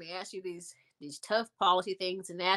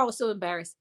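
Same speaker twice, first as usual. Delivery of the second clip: normal talking voice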